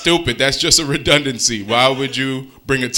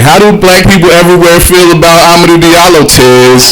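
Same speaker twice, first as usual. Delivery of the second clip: mock teacher; 7 semitones higher, 11 dB louder than usual. Imitating teacher asking.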